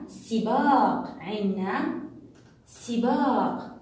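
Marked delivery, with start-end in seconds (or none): none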